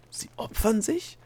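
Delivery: slow, high, rising question; incredulous echo. A narrator's reading. Delia echoes the gnome's answer, incredulous. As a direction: repeat it back in shocked disbelief.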